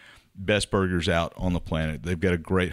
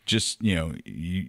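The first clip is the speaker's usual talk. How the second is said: ordinary talk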